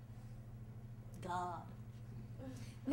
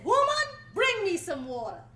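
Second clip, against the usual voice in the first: commanding; 4 semitones higher, 8 dB louder than usual. Acted. Stronger man commanding wife.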